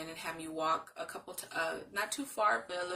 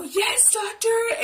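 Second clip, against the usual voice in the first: excited cheer; 13 dB louder, much slower than usual. Retold or imitated. High-fiving doctor over discharge news.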